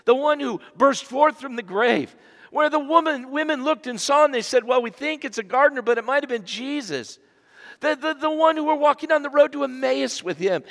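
excited sermon climb; preaching at full force. Acted. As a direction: build it up for the crowd. Raised preaching.

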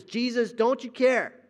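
a half-acted desperate shout; mock yell. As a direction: yell it up at the sky like a frightened disciple. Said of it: "We'll yell it like the disciples".

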